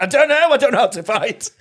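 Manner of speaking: high, loud, helpless wail; mock panicked cry. Failed peacemaker in a hallway scuffle, mock panic. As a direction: blurt it out in comic panic.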